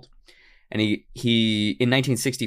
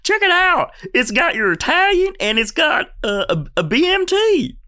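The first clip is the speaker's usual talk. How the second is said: very high, loud cartoon alien voice; excited squeaky alien; eager alien; 5 dB louder than usual. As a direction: pitch it way up, thrilled to show off. Continues the second alien's voice.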